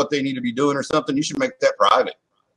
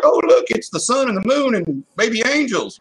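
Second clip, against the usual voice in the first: put-on high, sweet little-kid voice, much higher; 10 semitones higher than usual. Parent imitating a kid showing off a drawing, high childish voice. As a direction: squeak it out like a proud small child. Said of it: "they're like".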